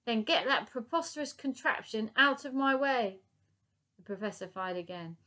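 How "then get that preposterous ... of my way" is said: high, loud angry retort. Acted. Professor retorting to Lady Buckshot, angry shout. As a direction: snap back at her.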